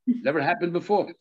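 usual speaking voice

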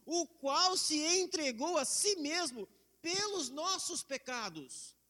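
slow, weighty reading of the verse; reading Galatians aloud, slow and solemn; solemn scripture reading. Acted. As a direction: let each word land slowly, as holy text. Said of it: "quando ele diz no verso quatro de Gálatas um".

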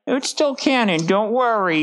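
high, reassuring put-on voice; squeaky reassurance; high mock creator voice. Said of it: "was kinda like".

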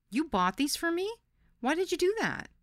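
surprised. A narrator's reading. Surprised by the gift.

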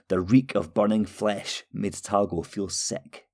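plain reading voice

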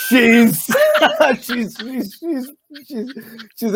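high-pitched, breaking up with laughter; can't get the words out for laughing. Real, not acted. Guest asked about his wife in the background, laughing.

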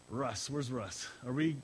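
ordinary talk

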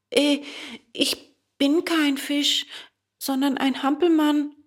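high-pitched, hesitant puppet voice; high, slightly timid character voice; puppet boy Bengele. A narrator's reading. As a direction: answer high as a little puppet.

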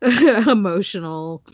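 slow, laughing through the word emotional; a teary laugh on emotional; laughing repeat. Real, not acted. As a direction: say emotional again while laughing.

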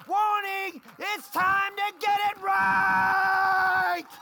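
loud, high, fervent preacher shout; preaching at full force. Acted. Pastor shouting the Spirit's warning to the congregation, crying out.

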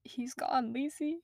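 very soft, barely there; broken murmur. Acted. The mother telling Lacey her son is dead, very soft and broken. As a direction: let the words fall out broken.

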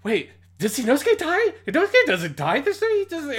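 high, loud panicked questioning; hammed-up high-pitched disbelief; retold sheer shock. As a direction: squeal in frantic confusion, doubting your own memory. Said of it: "this moment of sheer shock".

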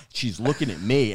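ordinary delivery

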